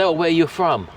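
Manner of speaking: a hint of a Polish tourist asking where you're from; Polish accent impression. Telling wife about Polish tourists, faint mock Polish accent. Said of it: "I'm trying to do their accent now".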